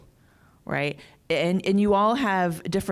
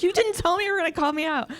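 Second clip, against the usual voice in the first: higher, fast mock protest; mock caught off guard; 13 semitones higher, faster than usual. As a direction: playfully cry foul at being picked. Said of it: "She's like".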